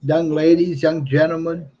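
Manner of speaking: ordinary talk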